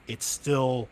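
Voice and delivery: ordinary delivery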